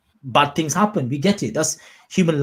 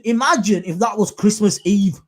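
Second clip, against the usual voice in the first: ordinary delivery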